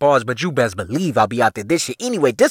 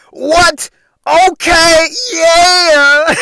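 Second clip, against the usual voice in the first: high, loud [name] yell; hyped-up [name] hollering; [name] impression; 14 semitones higher, 6 dB louder, much slower than usual. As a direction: shout it like [name] in a grill. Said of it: "I'm like".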